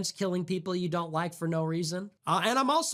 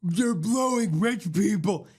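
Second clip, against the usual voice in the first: crude poor person; 2 semitones higher, 5 dB louder than usual. Retold or imitated. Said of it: "mouth-breathing poor person burst through".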